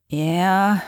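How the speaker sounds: reluctant, sleepy answer to a call; sleepy grumble. A narrator's reading. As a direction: grumble it half awake. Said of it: "she grumbled sleepily".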